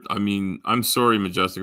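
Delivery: ordinary talk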